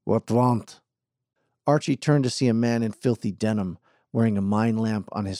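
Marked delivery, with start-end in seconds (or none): 0.0-0.8 put-on gruff Eastern European accent, clipped words. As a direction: bark it like a suspicious Eastern European miner. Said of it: "with an Eastern European accent".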